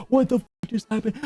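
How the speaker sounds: high, hushed mock alarm; alarmed bystanders. Shoppers flooding out of the store, imitated high-pitched alarm. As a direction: pitch it way up, like baffled onlookers. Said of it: "they're looking around like".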